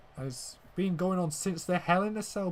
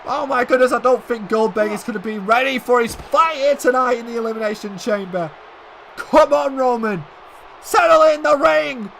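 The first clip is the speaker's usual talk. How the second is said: furious, much higher and louder ringside yelling; outraged shouting; 7 semitones higher, 11 dB louder than usual. Acted. Commentator yelling for security after Reigns' attack, furious shout. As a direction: scream at security like the attack just happened in front of you.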